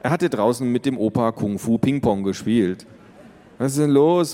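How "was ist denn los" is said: put-on higher, louder boy voice; curious boy. Brother entering from the terrace, curious. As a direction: come in asking what's up. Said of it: "fragte Max".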